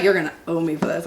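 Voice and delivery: imitated husband, low and laughing; low laughing grumble. Wife retelling her husband's threat, laughing low imitation. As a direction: laugh through a mock grumble. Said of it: "He's like".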